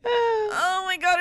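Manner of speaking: laughing exasperation. Real, not acted.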